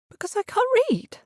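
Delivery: high, cracking hurt question; hurt girl, voice breaking. A narrator's reading. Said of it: "Her voice broke".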